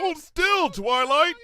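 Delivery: put-on young dragon shouting after galloping unicorn. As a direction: yell while chasing her. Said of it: "shouted Spike".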